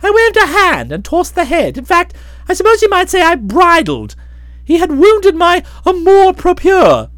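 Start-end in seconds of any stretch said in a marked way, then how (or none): none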